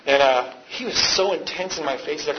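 usual speaking voice

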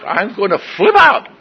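high, loud retold blow-up; exasperated outburst. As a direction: let the frustration burst out, hammed up. Repeats it louder, losing control.

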